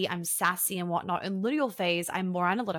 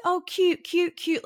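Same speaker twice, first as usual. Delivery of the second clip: much higher and louder cute cute cute; mock cooing; 10 semitones higher, 5 dB louder, slower than usual. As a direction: squeal it in mock delight. Mocking soft cooing response.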